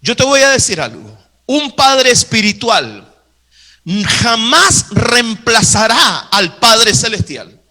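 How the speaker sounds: usual speaking voice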